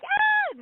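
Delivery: short, high-pitched squeal of overwhelm; mock shriek. Storyteller showing how she reacted to meeting the commander, mock shriek. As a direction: let out a high "ah" without much extra volume.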